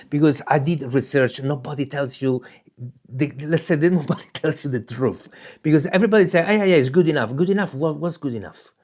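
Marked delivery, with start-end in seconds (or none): none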